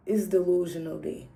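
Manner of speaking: ordinary delivery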